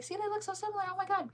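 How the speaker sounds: very high, retold gush to her sister; high-pitched, hammed-up excitement; excited squeal. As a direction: squeal it in mock amazement. Acts out showing sister the photo.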